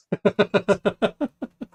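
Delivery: high laugh. Real, not acted. Laughs at own channel name.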